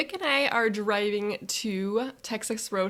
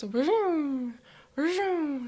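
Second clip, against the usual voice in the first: higher rising-falling vroom like a passing semi; imitated truck vroom; 7 semitones higher, 5 dB louder than usual. Telling her trip, imitating passing semis with a vroom. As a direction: make the trucks roar by.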